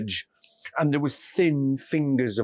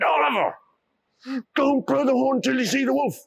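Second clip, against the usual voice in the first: annoyed; 11 semitones higher, faster than usual. A narrator's reading. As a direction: tell him off. Villagers scold Oliver.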